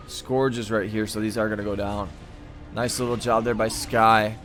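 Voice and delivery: ordinary talk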